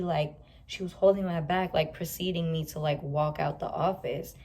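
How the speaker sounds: usual speaking voice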